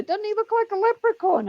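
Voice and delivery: very high-pitched retold jibe; mock teasing call. Colleague teases suspect before other cops, very high mock call. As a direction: pitch the joke right up, calling out.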